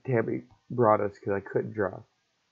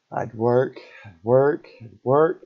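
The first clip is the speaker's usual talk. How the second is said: each "work" louder and rising, hammered home; building emphatic repetition; 7 dB louder, slower than usual. Real, not acted. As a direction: pound out every "I'd work" harder. Repeating how hard he worked.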